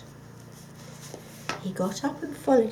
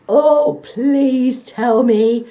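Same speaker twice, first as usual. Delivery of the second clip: loud, slow, drawn-out plea; pleading; 4 semitones higher, 15 dB louder, much slower than usual. Acted. The dog pleading with the fox, loud and drawn out. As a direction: beg him desperately. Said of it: "pleaded the dog".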